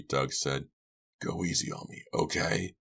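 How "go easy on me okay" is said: small, timid, pleading voice; wimpy little voice. Acted. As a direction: plead weakly like a coward. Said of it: "in a wimpy little voice".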